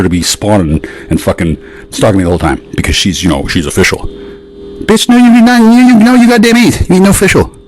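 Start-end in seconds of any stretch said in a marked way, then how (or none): 4.0-7.5 high-pitched scoffing tirade; high, derisive put-down; mock indignant outburst. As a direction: sputter in a high, outraged mock voice. Mocking outburst at her claim.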